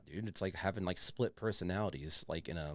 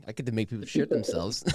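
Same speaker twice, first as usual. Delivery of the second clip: laughing; 5 semitones higher, 5 dB louder, faster than usual. Real, not acted. Laughing at the story.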